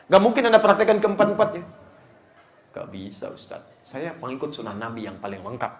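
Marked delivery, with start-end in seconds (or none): 2.8-5.7 hushed, low mock objection; objecting follower. Over-zealous follower tells the ustaz he follows all, hushed. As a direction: protest very softly and lower, as a pious pupil.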